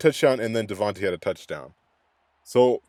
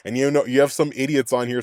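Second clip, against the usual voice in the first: normal talking voice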